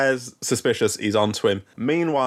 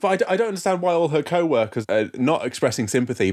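ordinary delivery

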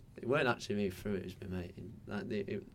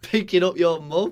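laughing through a teasing jab; cracking up as you tease; giggly mocking question; 7 semitones higher, 11 dB louder, slower than usual. Real, not acted.

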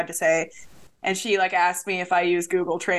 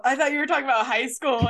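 fast, higher, laughing through the words; 5 semitones higher, faster than usual. Real, not acted. Co-host realizing it was college, laughing. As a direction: laugh at your own mix-up as you talk.

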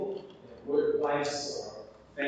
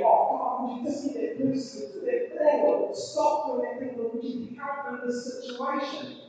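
high-pitched exasperated pleading; acted, put-upon begging; venting prayer; 11 semitones higher, 4 dB louder than usual. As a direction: whine a frustrated plea to God. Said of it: "she's just venting at Jesus".

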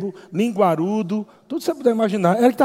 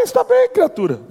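much higher, mock exasperated; 12 semitones higher than usual. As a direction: squeak it out in mockery. Mocking retort to friend.

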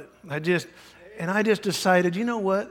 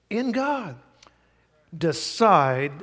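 ordinary talk

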